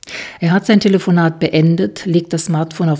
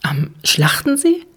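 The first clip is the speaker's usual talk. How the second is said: high, slow astonished question; surprised; 9 semitones higher than usual. A narrator's reading. Jule surprised to hear where Dirk lives, high and slow. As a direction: Jule catches the coincidence, eyes wide. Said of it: "Überrascht sieht Jule ihn an".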